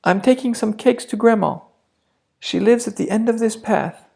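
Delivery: put-on a shy child, voice kept small. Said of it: "in a very small voice". (little girl tells the wolf, small voice)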